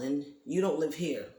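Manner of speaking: normal talking voice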